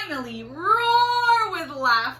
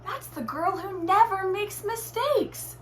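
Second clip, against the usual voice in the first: lightly voiced woman; 8 semitones higher than usual. A narrator's reading. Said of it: "said a woman".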